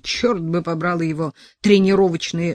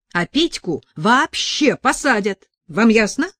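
higher and louder throughout, scolding; furious tirade; 6 semitones higher, 7 dB louder than usual. A narrator's reading. Sasha furiously scolding the mother and Petka, high and loud. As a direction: lay into them in fury.

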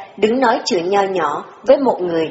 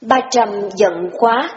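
usual speaking voice

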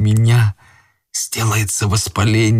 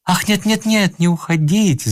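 high, urgent pleading cry; wife crying out; 12 semitones higher than usual. A narrator's reading. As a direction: beg him not to leave, voice up high. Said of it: "закричала жена".

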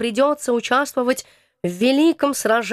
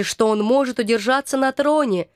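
ordinary delivery, nothing marked

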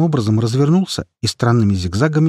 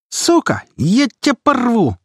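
high-pitched furious squeal; squealed threat; 13 semitones higher, 3 dB louder than usual. A narrator's reading. Humiliated fighter threatens his rival, squealing. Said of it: "провизжал униженный свищ".

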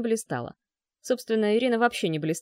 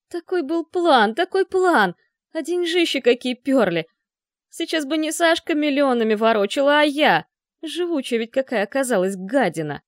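put-on loud, bitter, higher drunken rant; 5 semitones higher, 6 dB louder than usual. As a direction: rage at the lost money. Drunk man ranting about lost fortune.

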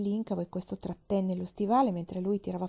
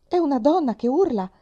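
alarmed exclamation; 7 semitones higher, 10 dB louder than usual. Acted. Father alarmed by night scream.

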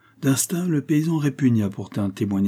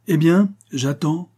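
slow, raised, higher prod; loud impatience, drawn out; impatient exclamation; 8 semitones higher, 5 dB louder, slower than usual. A narrator's reading. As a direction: show you are tired of waiting. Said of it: "impatienté".

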